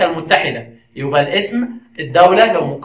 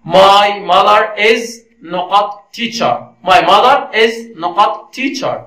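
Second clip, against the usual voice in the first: slow, word-by-word quiz reading; slow dictation; 2 semitones higher, much slower than usual. Shown as a demonstration. Teacher reading a gap-fill question to learners, slow dictation. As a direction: read the question out slowly, pausing at the gap.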